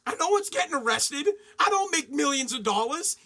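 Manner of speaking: usual speaking voice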